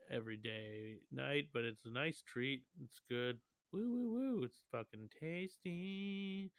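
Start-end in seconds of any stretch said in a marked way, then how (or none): 3.7-6.5 slow, drawn-out high talk; stoned. Real, not acted. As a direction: savour it, really high and slow. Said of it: "I'm really high".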